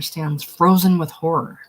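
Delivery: ordinary delivery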